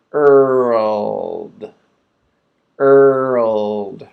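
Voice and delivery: drawn-out Earled demo. Shown as a demonstration. Teacher modeling Earled for students, slow and clear. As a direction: say Earled slowly with a curled R and an L. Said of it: "to make the L sound".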